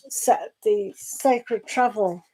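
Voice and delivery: usual speaking voice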